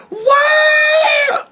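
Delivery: a high, loud mock squeal of delight; mocking scream. Mocking the women floating in zero G with a loud high squeal. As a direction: shriek it like a giddy passenger floating free. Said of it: "and just started screaming".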